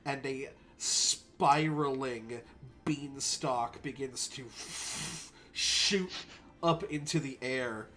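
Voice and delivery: unhurried storytelling with long pauses; game master scene-setting. Acted. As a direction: let the wonder unfold phrase by phrase. Game master narrates beanstalk growing.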